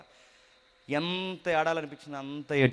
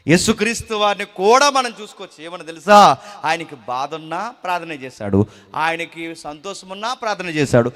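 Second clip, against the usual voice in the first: loud, high, fervent preaching; full-force preaching; 3 semitones higher, 14 dB louder than usual. Acted. Preacher thundering to the congregation about fear, loud and fervent. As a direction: drive the point home to the congregation.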